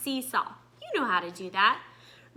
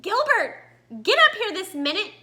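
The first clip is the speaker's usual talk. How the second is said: thundering mother; 7 semitones higher than usual. Acted. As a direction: call him up like an angry mom. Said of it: "Gilbert's mother thundered".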